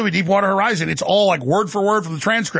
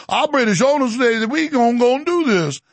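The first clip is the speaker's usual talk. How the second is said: imitated Cajun executive; Cajun accent; 3 semitones higher than usual. Executive citing British owners, mock Cajun accent. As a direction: put on a thick, slightly raised Cajun voice. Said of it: "executive in the coon-ass voice saying".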